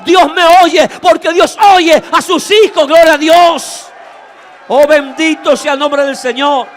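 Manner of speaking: loud, fervent preacher's proclamation; full-force preaching. Acted. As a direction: drive it home to the congregation.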